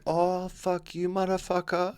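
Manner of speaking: lazily sneering prank caller; stretched-out mock insult.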